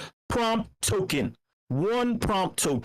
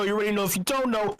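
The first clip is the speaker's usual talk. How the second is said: ordinary delivery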